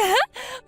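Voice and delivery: high, gleeful cackle; mocking laugh. Sister-in-law gloating over the ruined dress, high mocking cackle. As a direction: laugh right in her face.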